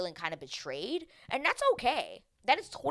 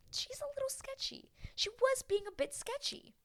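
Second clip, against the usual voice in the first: very high, hushed squeak; hushed admission; 4 dB quieter than usual. Acted. Viewer admitting she looked sketchy, hushed and high. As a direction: confide it in a tiny high voice. Confiding an awkward verdict.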